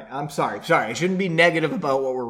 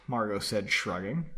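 usual speaking voice

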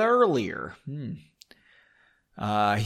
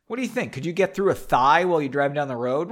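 usual speaking voice

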